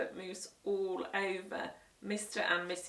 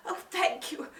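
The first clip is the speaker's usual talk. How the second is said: higher, breathy grateful guest; gasping thanks; 10 semitones higher than usual. Acted. Choking guest thanking Peter, gasping. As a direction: gasp out the thanks. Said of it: "gasped Missus Mossy".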